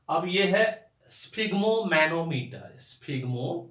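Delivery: स्फिग्मोमैनोमीटर said slowly and spelled out; careful pronunciation. Shown as a demonstration. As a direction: sound out स्फिग्मोमैनोमीटर slowly, syllable by syllable. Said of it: "इसका pronunciation जो होता है".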